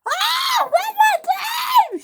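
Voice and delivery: high, drawn-out shriek; screamed. A narrator's reading. Millie with her caboose stuck, screaming. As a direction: scream it out, stretching the words. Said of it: "she screamed".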